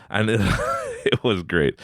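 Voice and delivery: normal talking voice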